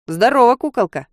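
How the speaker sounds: put-on loud, brash drunken greeting; drunken call-out. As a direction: holler it like a drunk. Drunk guy calling out to her.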